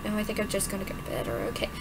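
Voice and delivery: soft, fast, fading reply; quiet mumble. Acted. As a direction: murmur it wearily.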